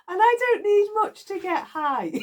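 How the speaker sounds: giggling through the words; laughing. Real, not acted. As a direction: still laughing, high and bright. Laughing about getting high on cacao.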